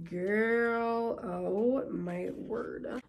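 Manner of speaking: low, hushed and very drawn out; slow, quiet amazement; awestruck. Real, not acted. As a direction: stretch it out softly, stunned. Stunned by a fancy pen.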